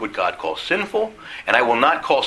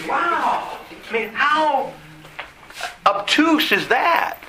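incredulous; 13 semitones higher, much slower than usual. Real, not acted. Incredulous at behaviour.

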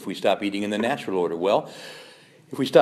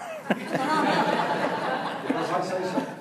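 laugh. Real, not acted. Laughs at own joke.

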